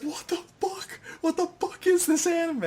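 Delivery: high squeaky what the fuck; incredulous high-voiced outburst; mock disbelief. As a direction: pitch way up in mock shock. Retelling own shocked reaction.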